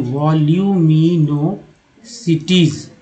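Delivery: वॉल्यूमिनोसिटीज sounded out syllable by syllable, very slowly; slow word demo. Shown as a demonstration. Teacher sounding out the next word to students, very slowly. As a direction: drag out वॉल्यूमिनोसिटीज for learners. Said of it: "next value".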